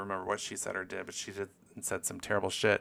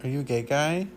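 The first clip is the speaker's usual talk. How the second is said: higher, playful question to the pet; teasing the dog; 4 semitones higher, 5 dB louder than usual. Pretend. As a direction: tease the dog.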